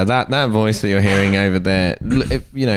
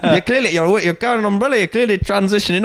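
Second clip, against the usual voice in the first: much higher, mocking Newcastle Geordie accent; 12 semitones higher than usual. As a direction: ham up a Newcastle Geordie lad. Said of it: "That's a hard accent to pull off".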